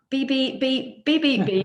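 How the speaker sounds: playful buzzer noise for the wrong answer; mock buzzer beeps. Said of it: "mock buzzer sound for hell".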